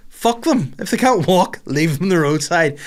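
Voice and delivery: hammed-up rant. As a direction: go over the top about it. Mock-outrageous rant about pedestrians.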